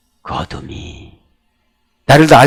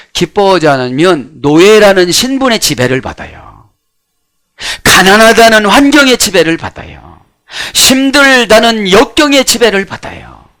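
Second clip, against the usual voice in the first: raised, emphatic pulpit voice; forceful preaching; 6 semitones higher, 6 dB louder than usual. Real, not acted. Preacher warns the congregation about being ruled by circumstances, forceful preaching. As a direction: preach it louder and higher, line by line.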